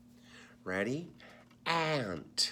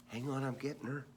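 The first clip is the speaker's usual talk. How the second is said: usual speaking voice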